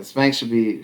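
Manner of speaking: usual speaking voice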